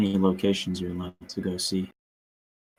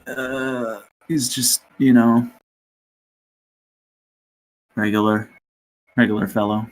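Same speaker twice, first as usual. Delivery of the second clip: mock innocent; 3 semitones higher, 4 dB louder than usual. Covering for odd-looking man.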